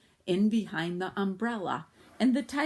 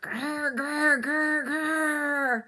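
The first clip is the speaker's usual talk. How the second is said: louder growling grr, rolling the r; tiger growls; 9 semitones higher, 6 dB louder, much slower than usual. A narrator's reading. Narrator imitates the tigers coming back, growling. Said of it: "a horrible noise that sounded like".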